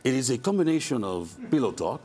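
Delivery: Latin lover. Shown as a demonstration. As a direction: purr it like a telenovela lover. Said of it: "I guess I would have to do it for you".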